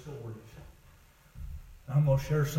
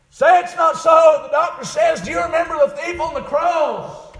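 high, raised pulpit voice; impassioned preached dialogue; 8 dB louder than usual. Acted. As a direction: act out the exchange in preaching voice. Preaching at full force.

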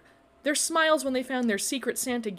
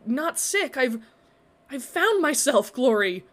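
louder, rising wonder; awed excitement; 4 semitones higher, 5 dB louder, slower than usual. A narrator's reading. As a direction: burst out with the discovery. Awed revelation.

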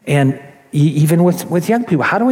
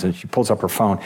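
ordinary talk, nothing marked

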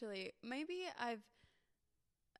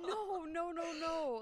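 retold hasty denial; 6 semitones higher, slower than usual. Retells hasty denial to a customer.

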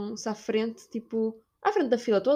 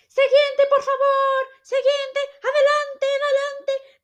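high, loud shrill mock bark of a gate agent; loud, high mock yelling staff; mocking shouting gate agent; 6 dB louder, slower than usual. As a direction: ham up a rude airline agent yelling the queue on. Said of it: "Tipo, a gritar para as pessoas".

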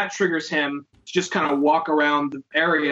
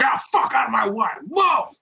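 put-on raised, pushy drunken holler; belligerent drunk; 8 semitones higher than usual. Imitating a drunk pushing through a bike shop, belligerent. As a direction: bark it like a drunk shoving through. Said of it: "drunken, belligerent asshole".

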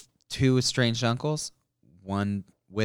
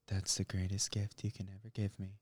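much softer, lower, reverent mom voice; hushed mock mom; 4 semitones lower, 14 dB quieter than usual. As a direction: breathe it like a moved mother. Voicing the mom in the show.

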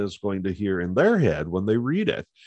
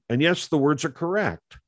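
ordinary delivery

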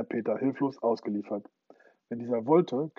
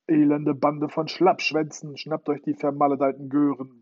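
roaring; 3 semitones higher, 7 dB louder than usual. Acted. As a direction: roar the curse at your cowardly crew. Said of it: "brüllte Hook".

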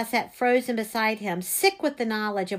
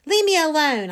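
high, loud brush-off; raised, irritated shout; calling back; 5 semitones higher, 7 dB louder than usual. A narrator's reading. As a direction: call back through the door, upset. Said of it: "I called back".

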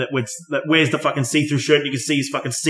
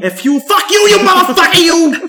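high, loud hammed-up tirade; put-on rage, pitched way up; mock angry cursing; 14 semitones higher, 4 dB louder than usual. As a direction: explode into a cartoonish swearing fit. Mock cursing outburst in character.

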